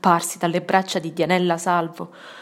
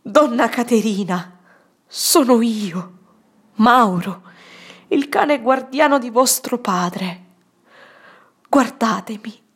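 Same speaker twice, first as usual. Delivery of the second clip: earnest pleading; 4 semitones higher, 4 dB louder than usual. Acted. Old servant pleads with dying woman.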